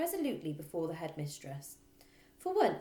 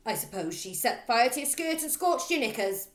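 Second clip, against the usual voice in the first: loud sneering jibe; scornful snort; 6 semitones higher, 9 dB louder than usual. Acted. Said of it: "Trunchbull snorted".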